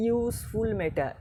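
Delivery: ইউজফুল said slowly with a clear "you" sound at the start; slow demo pronunciation. Shown as a demonstration. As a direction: stretch out ইউজফুল so the ইউ is heard. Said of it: "কিন্তু উচ্চারণটা কী করছি?". (ধীরে ধীরে ইউজফুল উচ্চারণ করে দেখানো)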